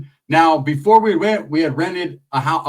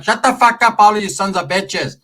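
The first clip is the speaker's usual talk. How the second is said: louder, higher fed-up dad voice; imitated angry dad; 5 semitones higher, 3 dB louder than usual. Dad silencing the arguing family, imitated angry shout. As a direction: bellow like a dad silencing squabbling kids. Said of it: "My dad's like".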